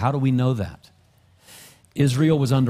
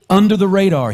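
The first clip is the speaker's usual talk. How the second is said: preaching louder and louder at full force; fervent preaching; 6 semitones higher, 7 dB louder than usual. Acted. Pastor building to the congregation, fervent preaching. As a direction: drive it home to the congregation, rising louder.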